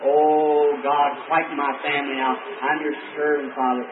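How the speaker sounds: soft, low, slow inward prayer; hushed plea to God for peace at home; silent prayer. Retold or imitated. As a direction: pray quietly inside, slowly. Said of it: "down in my heart, I'm saying".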